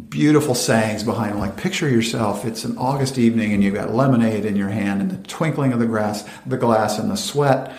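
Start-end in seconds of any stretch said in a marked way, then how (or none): none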